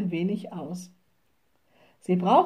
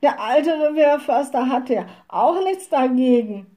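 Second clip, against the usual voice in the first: high, agitated complaint; upset women protesting, pitched up; crying out; 5 semitones higher, 4 dB louder than usual. A narrator's reading. Women lament the forester's ban to the witch, crying out. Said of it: "riefen die Weiber".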